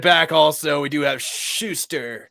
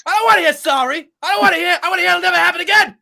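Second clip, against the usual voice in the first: high, loud hammed-up yelling; mock furious scolding; 6 dB louder than usual. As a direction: berate someone like an enraged wizard. Acted wizard scolding Bilbo.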